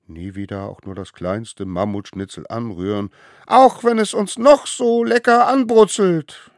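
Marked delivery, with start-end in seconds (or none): 3.5-6.5 high, loud solemn call; leading an oath. A narrator's reading. Smallest one calling the oath. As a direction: proclaim each word.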